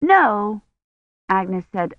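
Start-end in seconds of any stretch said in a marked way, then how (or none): 0.0-0.6 put-on higher, mildly alarmed child; a quick touch of alarm. A narrator's reading. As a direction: deny it with slight alarm.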